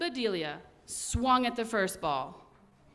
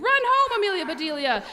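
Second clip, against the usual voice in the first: high, louder shout to run home; 9 semitones higher, 6 dB louder, faster than usual. Acted. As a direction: urge her home with a shout. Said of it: "shouted the boys".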